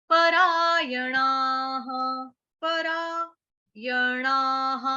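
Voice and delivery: परायणाः said very slowly, final visarga breathed as ha; drawn-out visarga. Shown as a demonstration. Teacher demonstrating the visarga sound of परायणाः. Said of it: "हा विसर्ग का उच्चारण हा से".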